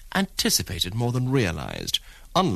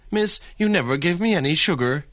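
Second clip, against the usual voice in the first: higher, louder, a complaining mock wail; 8 semitones higher, 3 dB louder than usual. Impatient diner complaining to the waitress, mock wail. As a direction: whine it like a short-changed diner. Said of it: "he wailed".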